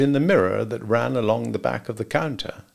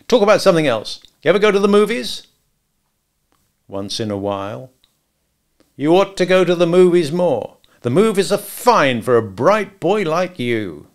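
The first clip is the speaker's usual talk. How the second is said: taunting gangster; 7 semitones higher, 6 dB louder than usual. Acted. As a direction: toy with the counterman. Max needles George.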